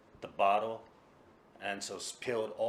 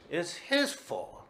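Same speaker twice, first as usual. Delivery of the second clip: indignant blame; 9 semitones higher, 5 dB louder, much slower than usual. Acted. Drunk man blaming Tora-san.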